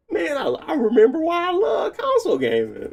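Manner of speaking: high, bright-eyed mock exclamation; retold relief. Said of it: "And then I'm like".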